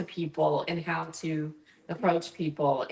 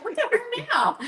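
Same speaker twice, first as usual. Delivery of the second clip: amazed; 4 dB louder than usual. Retold or imitated. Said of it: "they're like".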